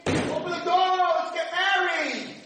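high, loud and slow; pleading prince. Retold or imitated. Prince calling to the princess behind the door, high, slow and loud. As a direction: draw out the plea.

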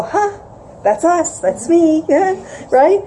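mock pride. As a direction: play the proud crowd claiming a hero. Mock collective pride reaction.

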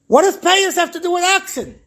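high, incredulous question; baffled challenge. Retold or imitated. Puzzled man questions the Ari's explanation, high and incredulous. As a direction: throw it back as an astonished objection.

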